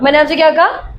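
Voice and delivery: ordinary talk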